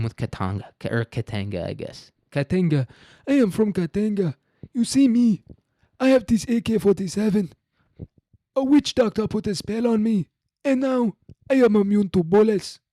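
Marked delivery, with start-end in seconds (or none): 2.3-2.9 put-on Katanga said high and loud, heavy African accent; African militia man voice. Host imitates an African militia man saying Katanga, heavy African accent. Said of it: "my African militia man voice". 3.3-12.8 put-on much higher and louder, heavy African accent; African militia man voice. Host imagines a Katanga militiaman bragging, heavy African accent. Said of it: "my African militia man voice".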